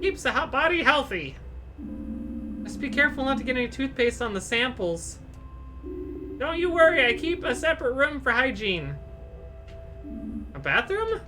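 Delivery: fussy doctor shooing the visitor away; eccentric doctor. Acted.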